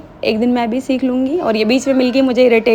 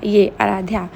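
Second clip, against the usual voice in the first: ordinary talk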